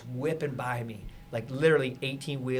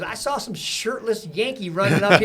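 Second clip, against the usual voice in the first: laughing chief; 8 semitones higher, 6 dB louder than usual. Retold or imitated. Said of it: "He goes".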